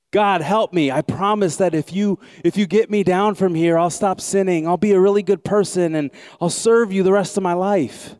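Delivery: high, loud frantic bargaining; hanging man begging God to save him; desperate pleading. Acted. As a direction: beg God with everything you have.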